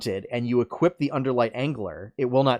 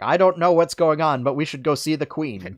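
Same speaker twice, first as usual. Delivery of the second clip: put-on higher, louder quoted old-lore character voice; Deckard Cain; 5 semitones higher, 5 dB louder than usual. Retelling the comic to co-hosts, lightly voiced clueless character. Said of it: "comic two focused on Deckard Cain".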